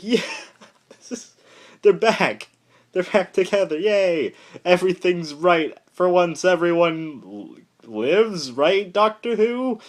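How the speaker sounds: high, giddy squealing gush; high fangirl squeal of joy. Retold or imitated. Her own gushing reaction, retold.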